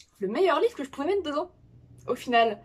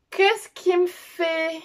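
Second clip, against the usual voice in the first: slow, drawn-out, higher-pitched question; puzzled muttered question; 7 semitones higher, 3 dB louder, much slower than usual. Real, not acted. Vlogger puzzled by her computer, slow drawn-out question. Computer acting up mid-recording.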